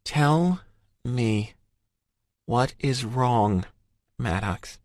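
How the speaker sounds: high, slow, each word pressed; insistent woman's voice. A narrator's reading. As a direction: drag out a firm demand. Emerson presses him slowly.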